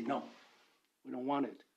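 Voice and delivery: ordinary delivery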